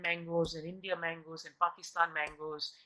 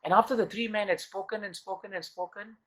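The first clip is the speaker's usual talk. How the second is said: ordinary talk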